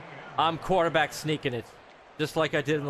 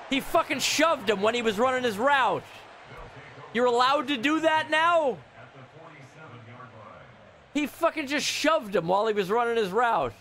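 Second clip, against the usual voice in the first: raised-pitch, indignant complaint; indignant protest; 5 semitones higher than usual. Real, not acted. Gamer objecting that the receiver was shoved, indignant. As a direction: protest the foul in disbelief.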